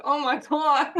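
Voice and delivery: laughing, high and loud. Retold or imitated. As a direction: gasp it out through laughter. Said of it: "I was like".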